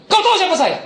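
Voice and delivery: high, loud intimidating question; menacing challenge. Retold or imitated. Pastor confronting a thug stealing from workers, loud and threatening. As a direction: make him flinch.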